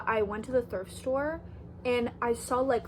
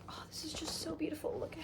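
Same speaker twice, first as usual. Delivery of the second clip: soft unvoiced whisper of awe; whispering admiration over the plaid bag; 5 semitones higher, 12 dB quieter, faster than usual. Real, not acted. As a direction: breathe it out in awe.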